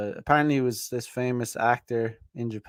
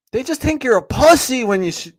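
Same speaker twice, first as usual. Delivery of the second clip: usual speaking voice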